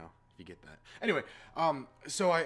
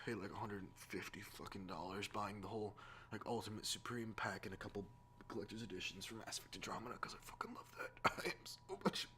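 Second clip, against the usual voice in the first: guilty whisper; 6 semitones lower, 12 dB quieter than usual. Real, not acted. Confessing his splurge on collector's editions.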